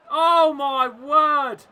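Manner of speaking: slow, loud shout of disbelief; loud, high cry of disbelief; stunned exclamation. Real, not acted. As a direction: drag it out in shock. Reacting to awful miss.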